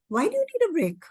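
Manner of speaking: teacher probing a pupil, pitched high; high questioning teacher. Acted. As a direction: ask it high and gently like a teacher to a child.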